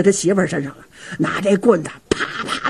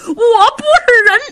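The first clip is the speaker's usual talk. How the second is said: high, slow, tearful self-reproach; crying remorse; 14 semitones higher, slower than usual. Acted. Uncle Liu Rong ashamed before his good nephew, tearful sobbing. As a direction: break down sobbing in shame.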